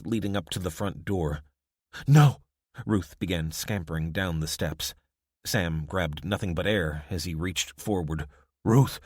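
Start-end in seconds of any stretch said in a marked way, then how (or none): 2.1-2.4 sharp loud high cry; shout. A narrator's reading. Ruth cries out to the child, loud alarm. 8.6-9.0 loud urgent call. A narrator's reading. Sam calls after his wife, urgent shout.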